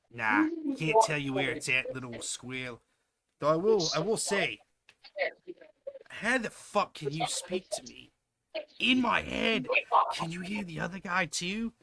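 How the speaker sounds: thick Aussie guard voice; Aussie accent. Acted. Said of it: "He sounds thickly Aussie".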